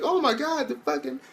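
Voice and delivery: very high, gushing squeal; hammed-up excited fangirl voice; mock fangirl. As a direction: imitate girls losing it over the show. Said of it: "They're like".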